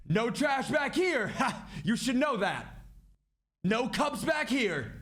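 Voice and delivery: high, slow, drawn-out indignant order; imitated loud complaint. Drunk passenger ordering the flight attendant, imitated loud complaint. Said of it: "and he says it loud".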